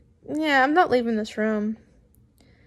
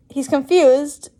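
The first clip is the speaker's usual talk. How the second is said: usual speaking voice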